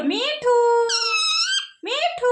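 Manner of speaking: high Mithu with long final vowel; word demo for parrot. Shown as a demonstration. Teaching the parrot its own name, high and stretched. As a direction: call out Mithu high, holding the end. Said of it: "मिठू बोलो".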